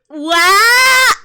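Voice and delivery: loud, high shout of disbelief; floored by the news; real shock. Real, not acted. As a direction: blurt it out, stunned. Reacting to shock plot twist.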